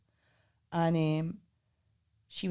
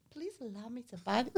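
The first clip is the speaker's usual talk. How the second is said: softly imitated courteous plea; old-fashioned gallantry; 2 semitones higher, 10 dB quieter than usual. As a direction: retell a gallant offer in a quieter voice. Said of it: "trying to be old-fashioned".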